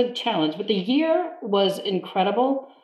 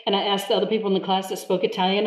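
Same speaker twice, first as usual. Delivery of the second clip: ordinary talk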